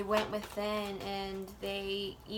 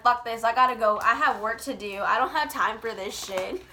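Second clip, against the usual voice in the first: laughing mock brush-off; 8 dB louder than usual. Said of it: "being like".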